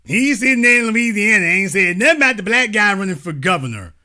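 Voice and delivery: lightly mock the man's words back at him; mocking mimicry. Host parroting a rival's clip back, mocking mimicry.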